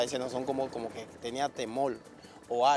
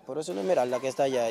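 louder and quick, a startled cry of recognition; startled shout; 2 semitones higher, 7 dB louder, faster than usual. Retold or imitated. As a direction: blurt it out as you spot her down the street. Spotting his missing daughter.

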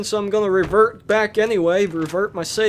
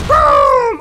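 boom; 8 dB louder than usual. Real, not acted. Firing dynamite.

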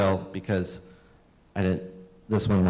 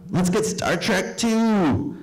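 put-on high, slow, dopey kid; slow high boy's voice; little kid voice; 10 semitones higher, much slower than usual. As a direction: drag it out like a young boy. Voicing childhood friend Scott.

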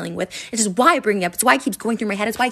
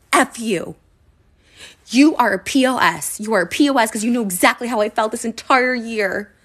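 angry yelling; 3 semitones higher, 6 dB louder than usual. Real, not acted. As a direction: spit it out in real rage. Real fury at former friend.

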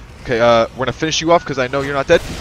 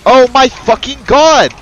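high, loud, slow outraged cry; exasperated shout; 9 semitones higher, 6 dB louder, slower than usual. Real, not acted. Player shouting at the game, slow exasperated yell. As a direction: let it rip in disbelief.

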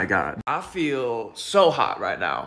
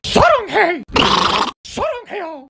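much higher squeaky cartoon mouse; Mickey Mouse squeal; 7 dB louder than usual. Recreating the Mickey Mouse audio, high cartoon squeal. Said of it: "recreating the Mickey Mouse Saranghel audio".

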